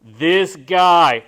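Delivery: loud, delighted "this guy" from the husband; impressed husband calling out; husband's excited praise. Retold or imitated. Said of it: "the husband said".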